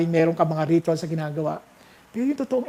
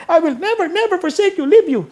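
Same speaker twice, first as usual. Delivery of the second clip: put-on high, fast, eager child voice, much higher; child talking to grandma; 11 semitones higher, 7 dB louder than usual. Grandchild telling lola about the Greek, high eager child voice. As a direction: squeal it out like an excited grandkid. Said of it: "Sabi niya".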